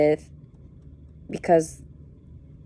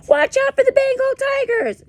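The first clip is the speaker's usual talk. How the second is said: high, squealing fake alarm; joking made-up warning; mock alarmed mother; 5 dB louder than usual. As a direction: play a fussing mother shrieking a warning. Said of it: "Just kidding, she didn't say that".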